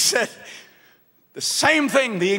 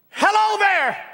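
calling out; 9 semitones higher than usual. Retold or imitated. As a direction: call out to a stranger in the dark. Voice calling across dark sea.

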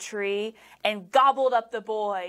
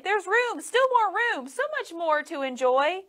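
put-on high, sweet boy voice; little boy gently urging; coaxing boy voice; 8 semitones higher than usual. A narrator's reading. Boy voice in story.

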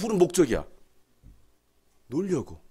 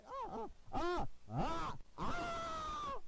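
mic test; 12 semitones higher, 19 dB quieter, much slower than usual. Shown as a demonstration. Testing a dead microphone.

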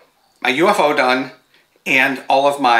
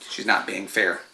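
ordinary talk, nothing marked

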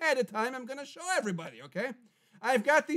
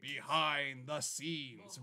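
imitating a trailer voice, low and slow; deep, drawn-out announcer imitation; movie announcer; 10 semitones lower, 9 dB quieter, much slower than usual. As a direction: drop low and soft, stretch each word. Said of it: "like a cool movie announcer".

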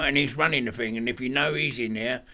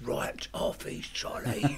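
whispered aside; 2 semitones lower, 8 dB quieter, much slower than usual. Retold or imitated. Describing a man off his head.

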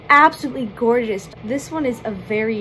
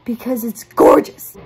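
excited; 9 dB louder than usual. Real, not acted. Gushing over eyeshadow.

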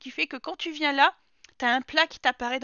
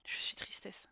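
breathy whisper, playfully sad; mock-tragic whisper; whispered mock sadness; 19 dB quieter than usual. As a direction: whisper "Je suis tristesse" like a sulking child. Sad about sim's fire fading.

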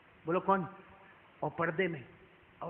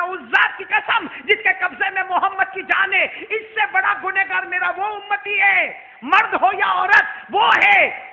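solemn oath; 11 semitones higher, 13 dB louder, slower than usual. Acted. The Prophet's solemn warning.

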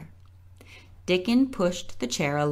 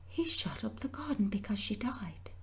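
softer whispered aside; half to herself; 5 semitones higher, 10 dB quieter than usual. A narrator's reading. Mary thinking aloud beside Colin's bed, whispering. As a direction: murmur it to yourself, not to him. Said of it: "said Mary half to herself".

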